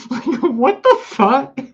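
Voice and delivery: high, loud, slow exasperated cry; loud incredulous "what the fuck"; disgusted outburst. Real, not acted. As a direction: drag it out in disbelief. Disgusted by the cat.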